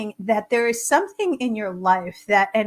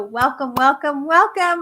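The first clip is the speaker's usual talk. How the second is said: each welcome louder and higher; building excited welcome; 5 semitones higher, 6 dB louder, slower than usual. Acted.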